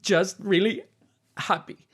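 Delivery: high, strained voice pretending all is fine; a crying innkeeper insisting she's happy; Cora holding back tears. Cora tells the party she's fine, high and tearful. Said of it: "And she's like".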